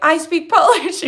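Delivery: proud boast. Retold or imitated. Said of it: "I'm always like".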